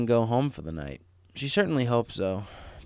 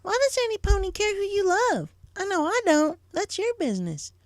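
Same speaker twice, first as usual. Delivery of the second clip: put-on very high, young filly voice; a curious little sister, voiced high. A narrator's reading. Little sister asking big sister, young high voice. Apple Bloom voiced in story.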